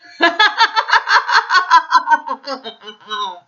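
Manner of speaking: loud, high forced cackling; Man's dreadful forced laughter. A narrator's reading. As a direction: laugh horribly. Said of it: "actually a dreadful sound".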